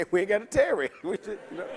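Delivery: laughing. Real, not acted. Joking about tarrying.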